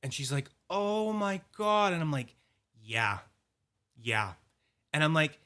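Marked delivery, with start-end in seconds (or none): 0.7-1.9 higher, drawn-out sympathetic gasp of words; stretched, pitched-up retold dismay; mock dismay. Clerk reacting to sad story. 2.8-4.3 low, grave put-on agreement; mock-solemn, low replies; solemn confirmation. Solemnly confirming his sad story.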